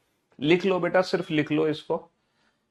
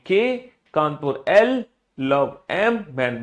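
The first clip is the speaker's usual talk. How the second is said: long drawn-out letter, then its partner; call-and-response drill; 3 dB louder, much slower than usual. Shown as a demonstration. Teacher has all pupils say it along, drawn-out drill. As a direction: stretch each letter out to cue the class. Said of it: "मेरे साथ भी जोर से बोलो".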